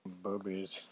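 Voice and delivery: ordinary talk, nothing marked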